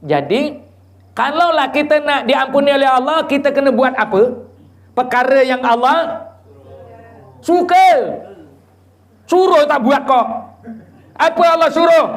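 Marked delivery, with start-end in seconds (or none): 1.1-12.0 high, loud preaching punch on suka; full-force preaching. Acted. Preacher quizzing the crowd on what God wants, full-force preaching. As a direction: build to a shout on suka.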